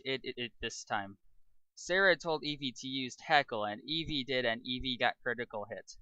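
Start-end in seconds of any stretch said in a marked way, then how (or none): none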